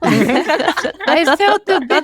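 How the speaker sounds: real laugh, loud, slow and high, through the words; embarrassed giggle over the whole line. Real, not acted. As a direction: laugh it out, a bit sheepish. Laughing at her own rudeness.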